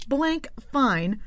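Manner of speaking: "Blank Fine" in two separated syllables, rising; careful corrected surname. Real, not acted. Host repeating the corrected name, "Blank Fine" carefully split in two. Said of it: "Uh, I'm sorry".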